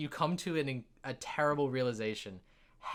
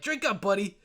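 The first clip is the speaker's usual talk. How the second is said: raised, louder voice of a drinking buddy; jovial dwarf; 7 semitones higher, 5 dB louder than usual. Acted. Dwarf hands Wilhelm more mead, jovial urging. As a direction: urge a pal on heartily.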